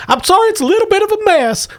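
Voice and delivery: very high, quick hostess voice; Gamorrean woman. Acted. Hostess apologizing for her messy house, high and hurried. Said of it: "She comes".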